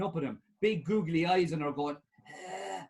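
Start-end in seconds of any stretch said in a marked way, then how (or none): 2.3-2.8 faint groan. Acted. Dying panda's faint groan.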